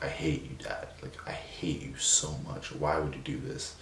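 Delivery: soft, near-whispered, breaking grief; quietly sobbing at his dad; retold tearful outburst. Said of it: "start crying again".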